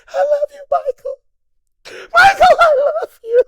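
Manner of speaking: very high, loud, tearful fan wail; hammed-up shrieking cry of love; crying groupie. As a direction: sob it out like an overwhelmed fan. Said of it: "crying like a little groupie bitch".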